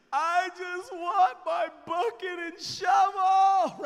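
put-on loud, slow, high wailing of a crying toddler. Son wailing in the car for his bucket and shovel, loud high cry. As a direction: wail it out like a heartbroken little boy.

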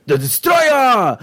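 "Destroyer" yelled as "Des-troy-AH"; exaggerated pronunciation. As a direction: shout "Destroyer" with a long high "-yah" ending. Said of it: "'cause that's how it's gone".

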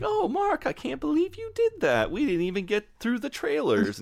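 put-on very high, breathless girly voice; giggly girlfriend. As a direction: squeal it like a giddy girlfriend. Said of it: "giggling and laughing".

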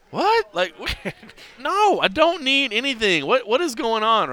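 high, loud bewildered protest; exasperated disbelief. Retold or imitated. Drinker refusing the pushy woman's offers, incredulous.

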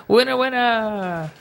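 hyped giveaway announcement. Real, not acted. Announcing contest winner.

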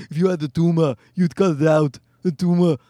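mock Austrian accent; Schwarzenegger. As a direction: put on a thick Austrian accent, dropping the final r's. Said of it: "Schwarzenegger wanted to have a viral video where he's going".

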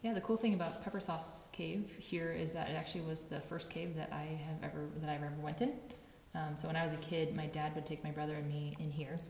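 usual speaking voice

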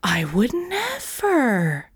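high, slow, wide-eyed innocence; I would never, all injured innocence; mock innocent. A narrator's reading. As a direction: play it sweetly innocent. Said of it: "as if to say".

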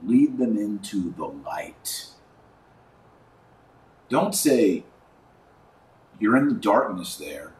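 ordinary delivery

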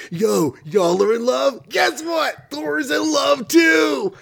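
high excited shout at the lovers; hammed-up lovestruck god yelling his news; bragging Thor. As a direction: announce it to the whole park. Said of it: "He's like".

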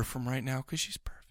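sheepish whisper to the co-host about his wife; whispered aside. Real, not acted. Sheepishly whispering to his co-host about his wife. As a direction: tuck it in under his breath.

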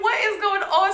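high-pitched bewildered question; shocked disbelief. Retold or imitated. Storyteller reliving her reaction to the man's outburst, shocked disbelief.

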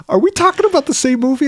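high, quick puzzled question; retold bewilderment, fast and high; retold confused son. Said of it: "he was just confused".

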